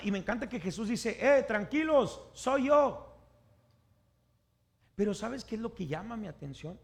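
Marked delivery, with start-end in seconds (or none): 1.2-3.2 high, loud, drawn-out shout to calm them; calling out reassurance. Acted. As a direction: holler it across the waves. Jesus calling out to disciples.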